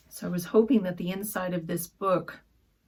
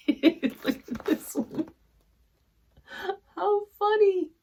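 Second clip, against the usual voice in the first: higher, laughing through the words; real amused laughter; 12 semitones higher than usual. Real, not acted. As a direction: giggle out the words at something silly. Laughing at a funny pattern.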